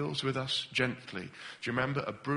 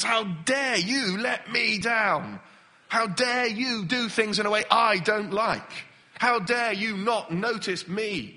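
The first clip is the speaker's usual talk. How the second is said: higher, slow, affronted outrage; mock indignant protest; 7 semitones higher, 3 dB louder, slower than usual. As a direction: act out wounded pride. Voicing proud inner thoughts.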